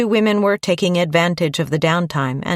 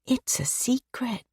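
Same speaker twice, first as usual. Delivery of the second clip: put-on high, soft child's whisper; a small girl whispering a secret; little girl whisper; 8 semitones higher, 8 dB quieter than usual. A narrator's reading. Little girl repeats her secret.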